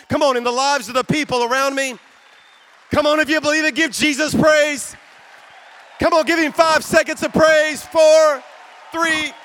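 loud, high rallying cry; preaching at full force. Real, not acted. Pastor rallying the conference to praise Jesus, shouting. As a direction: whip the crowd into praise.

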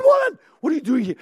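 high, fast shouted challenge; exasperated judge. Acted. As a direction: bark it at an unwelcome intruder. Acted exasperated judge confronting the woman.

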